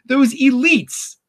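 high whiny doomsayer; hammed-up woe is me; mock whining. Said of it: "like, cry and scream".

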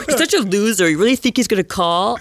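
teasing put-down in a schoolmate's voice; mocking teasing. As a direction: imitate a scornful classmate. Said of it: "like, teasing me".

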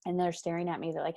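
usual speaking voice

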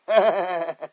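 bursting into helpless laughter; real laugh. Real, not acted. Caller's question sets him laughing out loud. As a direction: laugh out loud, can't hold it. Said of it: "I started laughing".

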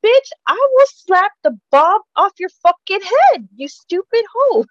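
angry pretend rant on Whoopi's behalf. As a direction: scream it at her, high and loud. Imagined rant at Barbara.

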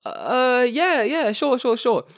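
high-pitched, hurried-sounding yeses; acted awkward eagerness; overeager agreement. Acting out his eager agreement.

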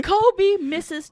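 very high, loud taunting call; mock shot-call shout. Ribbing someone who missed the shot, mock shout. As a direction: crow it out over a missed shot.

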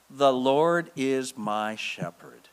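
slow, low, reverent; slow scripture. Shown as a demonstration. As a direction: let the verse land. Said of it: "what are those words?".

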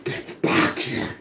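slow breathy growl, barely voiced; low raspy voice. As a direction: rasp it out low like a stalking monster. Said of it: "he says in a low, raspy voice".

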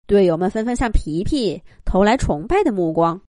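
plain reading voice

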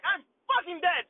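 high, loud yell of despair; panicked yell. Retold or imitated. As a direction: shout it like it's all over. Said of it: "And I'm like".

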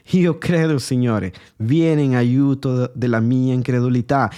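normal talking voice

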